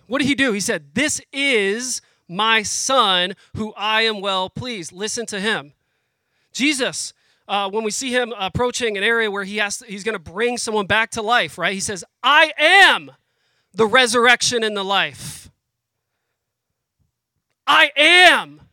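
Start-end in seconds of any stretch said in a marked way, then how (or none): 0.9-5.7 slow deliberate quote. Retold or imitated. Repeating God's words slowly. 12.2-18.6 loud ringing declaration, pitched up; proclaimed loud. Retold or imitated. Preacher voicing Jesus to the congregation, loud and ringing.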